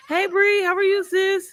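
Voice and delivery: high, bright hello to a friend; light up at a friend joining; greeting Bree in the chat, high and excited. Real, not acted.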